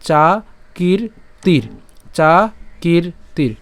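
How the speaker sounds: চাকীর্তির very slowly in three pieces, "chaa, kiir, tir"; slow spaced-out model of চাকীর্তির; long then short i. Shown as a demonstration. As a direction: hold "kiir" long in চাকীর্তির, separate each syllable. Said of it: "তাহলে উচ্চারণটা কেমন হচ্ছে".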